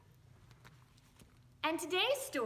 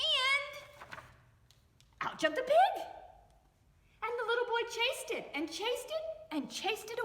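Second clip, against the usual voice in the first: higher and louder, bursting out; excited; 10 semitones higher, 4 dB louder, faster than usual. A narrator's reading. Narrator describing the rooster escaping, excited and high. Pig jumps out.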